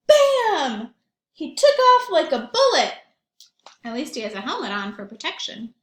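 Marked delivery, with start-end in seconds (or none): 0.1-2.9 loud and high, a sudden blast; excited burst. A narrator's reading. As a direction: shout it out like a cannon shot. Penguin fired from cannon.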